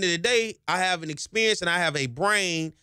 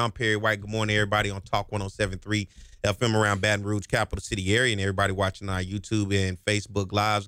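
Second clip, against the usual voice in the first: lower, smooth show-host voice rolling through the plugs; radio host announcer read; 7 semitones lower than usual. Acted. Host welcoming back radio and YouTube listeners, lower announcer voice. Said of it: "You see how I push things".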